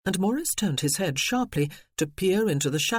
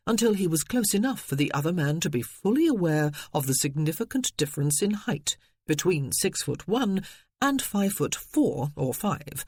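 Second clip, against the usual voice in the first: ordinary delivery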